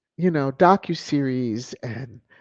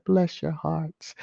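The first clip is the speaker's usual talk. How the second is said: slow, sugary Southern "Bless your hearts"; Southern saying; 4 dB quieter, slower than usual. Shown as a demonstration. To the haters, a slow Southern-style blessing. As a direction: drawl it slow like a Southern lady. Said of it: "I live in the South now".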